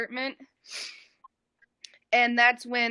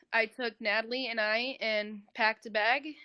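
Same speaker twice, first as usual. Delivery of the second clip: ordinary talk, nothing marked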